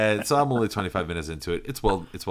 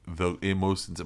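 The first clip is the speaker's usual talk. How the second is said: ordinary talk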